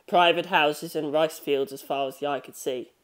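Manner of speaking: ordinary delivery, nothing marked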